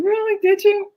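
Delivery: high, sarcastic really; mock surprise. Wife to husband's admission, high mock surprise.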